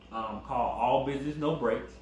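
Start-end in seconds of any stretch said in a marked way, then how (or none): none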